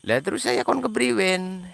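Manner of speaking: high, exasperated appeal; exasperated question. Real, not acted. Streamer asking viewers what he should do, exasperated. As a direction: throw it back at the critics, high-pitched. Throws question back at critics.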